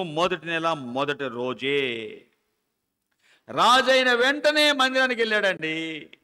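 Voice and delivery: loud, high fervent preaching; preaching at full force. Acted. Preacher to the congregation about the temple doors, fervent loud preaching. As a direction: preach it out, rising and ringing.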